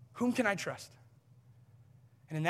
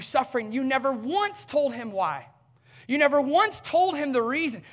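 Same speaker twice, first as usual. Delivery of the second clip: loud, higher, indignant questioning of God; frustrated outburst; 7 semitones higher, 7 dB louder than usual. Retold or imitated. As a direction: throw the accusations at God, rising in anger. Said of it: "Here's what I'm frustrated about. I'm like".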